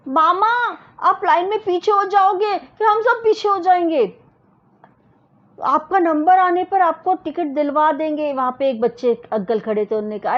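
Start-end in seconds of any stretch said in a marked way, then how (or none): 0.1-8.3 put-on high, loud child's call; child shouting. Bittu calling out to his uncle from the queue. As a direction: shout up to uncle, eager and urgent. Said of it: "Bittu चिल्लाया".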